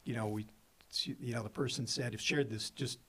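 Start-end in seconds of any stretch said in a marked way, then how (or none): none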